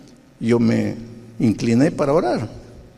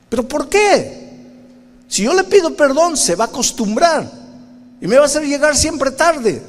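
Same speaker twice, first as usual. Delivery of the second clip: put-on much higher, louder childish whine; whiny protest; 13 semitones higher, 3 dB louder than usual. Preacher whines at the Spirit, high childish protest.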